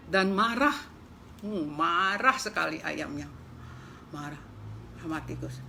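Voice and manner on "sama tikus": ordinary delivery, nothing marked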